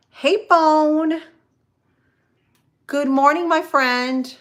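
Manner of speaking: high, loud, hearty hello; calling out greeting. Real, not acted. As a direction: call out to a friend who just turned up. Calls out greeting to arriving friend.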